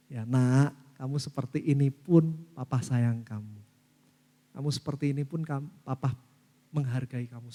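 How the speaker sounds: low, soft and gentle to his son; tender fatherly love. Retold or imitated. Father telling his troubled son he loves him, soft and tender. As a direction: embrace him with your voice.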